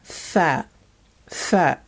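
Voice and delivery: F said as a short syllable, fa; English F sound. Shown as a demonstration. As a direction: say F as fa, like the f in infinity. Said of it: "This is the English F sound".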